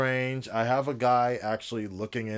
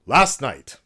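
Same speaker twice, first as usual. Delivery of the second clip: mock presidential address; 5 semitones higher, 12 dB louder than usual. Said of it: "I don't know why I keep doing this accent".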